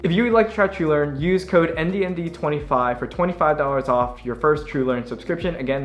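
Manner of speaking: upbeat sponsor read voice. Acted. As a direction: sell it brightly like an ad.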